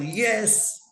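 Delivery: imitated gleeful cheer; triumphant approval. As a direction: stretch it upward in triumph. Repeats own triumphant approval.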